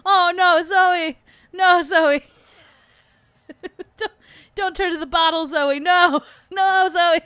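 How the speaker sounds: high, loud mock alarm at the dog; mock scolding. Owners scolding dog grabbing a plush beer can, loud mock alarm. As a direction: scold the dog in playful panic. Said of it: "we're like".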